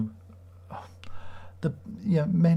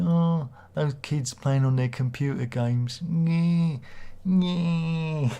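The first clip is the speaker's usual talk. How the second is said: mocking old-man grumble, drawn out with higher whines; Grandpa Simpson moan; 5 semitones higher, much slower than usual. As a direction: moan slowly like a grumpy cartoon grandpa.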